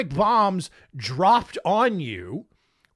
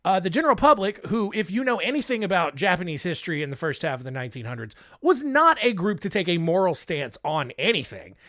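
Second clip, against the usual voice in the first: ordinary talk, nothing marked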